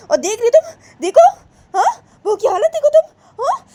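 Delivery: very high, loud threats turning tearful; a grandma threatening her son, then wailing; shrill threats. Acted.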